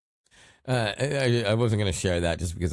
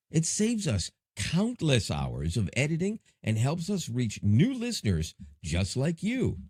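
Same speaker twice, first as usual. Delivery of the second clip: bright, upbeat sponsor read; smooth promotional voice, eager to please; podcast ad read; 4 semitones higher than usual. Acted. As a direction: sell it like a host-read ad.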